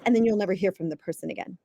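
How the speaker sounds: ordinary delivery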